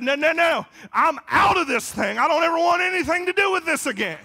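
loud, high, emphatic preaching shout; shouted refusal. Retold or imitated. Former alcoholic rejecting any return to the bar, shouted refusal. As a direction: refuse it at full volume.

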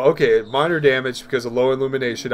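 ordinary talk, nothing marked

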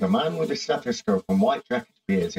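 ordinary talk, nothing marked

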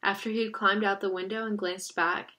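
plain reading voice

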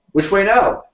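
high, loud shouted question; shouting. A narrator's reading. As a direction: yell for directions over galloping horses. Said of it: "shouted William".

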